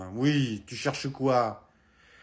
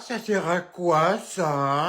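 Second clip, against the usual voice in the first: ordinary talk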